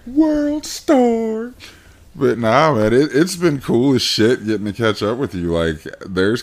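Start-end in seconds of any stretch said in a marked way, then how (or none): 0.1-1.5 very high, drawn-out meme call; hammed-up hype shout; mock World Star call. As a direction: call it like a viral-video hype yell.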